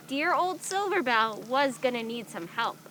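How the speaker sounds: usual speaking voice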